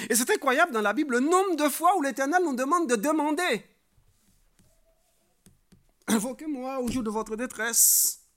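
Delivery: high, ringing, loud proclamation; full-force preaching. Real, not acted. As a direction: proclaim scripture to the whole congregation.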